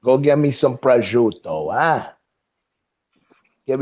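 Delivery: lightly hammed-up Brooklyn wise guy; mock New York mobster. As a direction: play the movie mobster giving orders. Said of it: "I am a New Yorker, tried and true".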